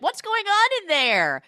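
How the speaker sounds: high loud mock call. Principal calling through the locked door, loud mock yell.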